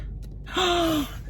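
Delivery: sharp high gasp; shocked gasp. Real, not acted. Sees ruined eyebrows.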